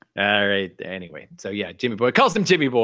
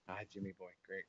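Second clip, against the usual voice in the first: much softer, half-hearted mumble; muttered retry of the accent; 9 semitones lower, 28 dB quieter, faster than usual. Retold or imitated. Host mutters a failed retry of the Bones greeting, much softer. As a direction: throw the line away under your breath. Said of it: "can do none of the accents".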